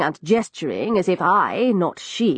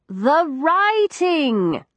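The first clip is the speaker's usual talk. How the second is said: very slow, higher, spelled out for the deaf; slow loud repeat; 8 semitones higher, much slower than usual. A narrator's reading. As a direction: drag the words out so she can hear. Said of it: "I tried again".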